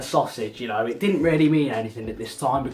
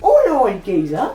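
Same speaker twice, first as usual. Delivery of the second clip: 1 teacher's cheery reply; 5 semitones higher, 7 dB louder than usual. Retold or imitated. Teacher answering the banter.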